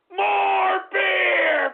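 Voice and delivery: high, drawn-out yell; hollered demand. Real, not acted. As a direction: holler it out for another drink. Hollers for beer mid-rant.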